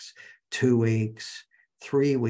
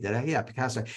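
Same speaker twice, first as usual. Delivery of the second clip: ordinary talk, nothing marked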